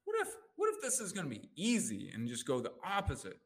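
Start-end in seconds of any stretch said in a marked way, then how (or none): 0.1-2.1 higher-pitched, wondering what-if; hopeful reframe. Shown as a demonstration. Coach demonstrating how to interrupt a negative pattern, hopeful. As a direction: lift it into an open, hopeful question. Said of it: "you interrupt that pattern and you say".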